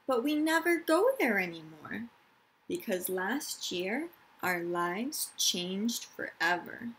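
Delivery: calm storytime reading; reading to children. Acted. Teacher reading a picture book to her class, gentle storytime.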